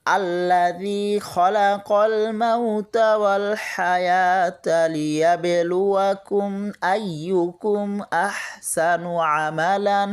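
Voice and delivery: slow, drawn-out melodic Quran chant; Quranic recitation. Shown as a demonstration. Teacher reciting the verse for students, slow melodic tilawat. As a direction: recite it slowly like tilawat, stretching each vowel.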